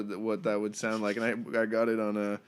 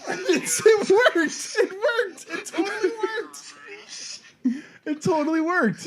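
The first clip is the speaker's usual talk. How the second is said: reversed playback; slower than usual. Shown as a demonstration. Reversed playback of backwards speech.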